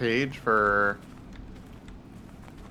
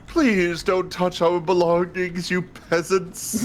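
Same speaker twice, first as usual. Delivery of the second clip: higher snooty noble voice; posh voice; 7 semitones higher, 3 dB louder, slower than usual. Retold or imitated. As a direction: sneer it like a haughty lord. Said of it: "That's what I was thinking with it".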